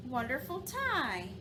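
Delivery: plain reading voice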